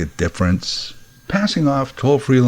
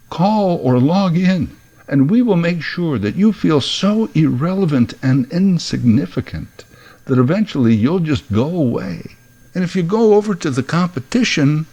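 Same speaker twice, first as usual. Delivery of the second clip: mock company pitch; 7 semitones higher than usual. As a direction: sell the insult like a cheery company slogan. Mock customer service pitch.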